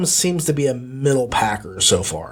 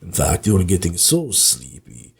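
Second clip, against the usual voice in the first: put-on low, whispery, slowing hypnotic Spanish-accented purr; sleepy low Banderas whisper sliding into Count Dracula; Antonio Banderas impression drifting into Dracula; 8 semitones lower than usual. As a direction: lull them to sleep like a seductive vampire. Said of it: "Antonio Banderas talking like this".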